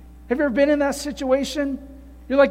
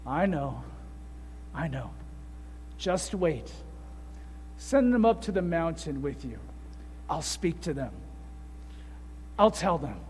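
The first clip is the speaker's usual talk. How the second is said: much lower and softer, gentle and calm; reassuring Father; 7 semitones lower, 10 dB quieter than usual. Retold or imitated. The Father reassuring Jesus, low gentle calm voice. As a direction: soothe him, low and unhurried. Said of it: "the Father telling Jesus".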